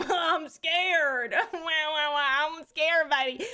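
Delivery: hammed-up scared whine, laughing through it; mock scared whimper. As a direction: play a big baby clinging on, half-laughing. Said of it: "And I was like".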